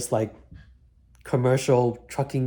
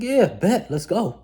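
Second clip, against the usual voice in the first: excited yes; 9 semitones higher, 4 dB louder, slower than usual. Retold or imitated. Eager reply to the instructor.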